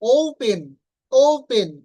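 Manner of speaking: "ওপেন" loud, stressed o-u, aspirated p; correct pronunciation. Shown as a demonstration. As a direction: punch the opening glide of "ওপেন". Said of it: "not ওপেন". ("ওপেন" জোরে, ওউ-ফেন)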